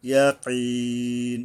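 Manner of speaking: slow ইয়াকিন with back "q", long "ee"; deep qaf. Shown as a demonstration. Teacher shows qaf and stopping, ইয়াকিন drawn out. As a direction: hold ইয়াকিন three beats on the last vowel. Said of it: "এই বড় কফ উচ্চারণে সাবধান".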